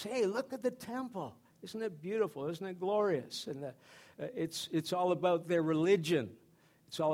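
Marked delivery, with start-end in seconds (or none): 0.0-3.5 mock wonder, starting high and then easing; admiring disciples. Acting out the awed disciples.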